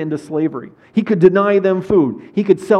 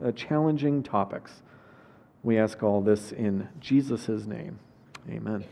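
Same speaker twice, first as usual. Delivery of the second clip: soft, lower prayer voice; 5 semitones lower, 4 dB quieter than usual. Acted. Pastor praying over the congregation before the sermon, soft and lowered. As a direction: pray it gently before the reading.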